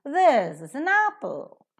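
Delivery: "This is an apple" with THIS and APPLE high and stretched, weak "is an"; accent THIS and APPLE, run lightly through "is an"; stress on THIS and APPLE. Shown as a demonstration. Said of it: "the pronunciation will be".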